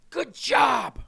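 encouraging someone nearby with a shout; called-out praise. Real, not acted. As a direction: call it out to cheer someone on. Calling out praise.

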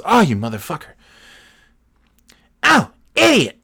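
high, loud cry of the friend who just got pranked; busted exasperation. Retold or imitated. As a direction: shriek it as the fooled friend catches on. Friend realizes the prank call.

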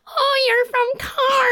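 high-pitched, slow mock exclamation; exaggerated teasing from the locals back home; mocking hometown crowd. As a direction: stretch it out in a squealing mimicry. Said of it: "then they're all like".

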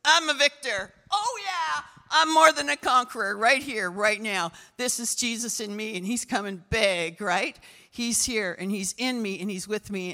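loud, victorious proclamation; voicing the body's triumphant boast; triumphant declaration. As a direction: shout it like a conqueror. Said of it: "my body says".

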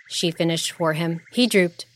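ordinary delivery, nothing marked

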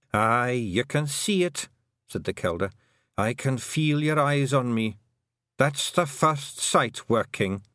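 put-on high, Scots-accented old Pictsie matriarch; a tiny Scottish clan mother, proud and fond; old Kelder voice. The Kelder telling Tiffany about her sons, high and Scottish.

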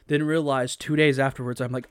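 ordinary delivery

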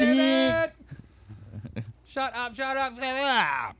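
very high, strained sitcom mimicry; Ross from Friends. Host replaying the couch scene, high hammed-up yelping. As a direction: squeal it out, stretching the last words. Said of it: "I'm gonna be Ross".

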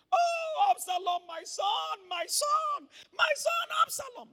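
high, loud wailing for his son; wailing lament. Acted. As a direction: cry out like a grieving father. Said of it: "That was what he kept saying".

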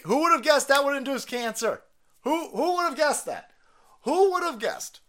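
high-pitched mock disbelief; sarcastic astonishment. Host mocking a cancer article, sarcastic astonishment.

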